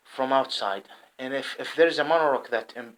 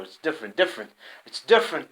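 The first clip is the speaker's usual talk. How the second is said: normal talking voice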